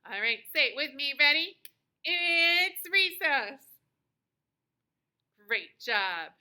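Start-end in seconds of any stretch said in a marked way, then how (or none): 2.0-3.6 loud, high, drawn-out cheer; exclamation mark in the voice; super excited exclamation. Shown as a demonstration. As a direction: announce it big, stretching the words out. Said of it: "you're super excited".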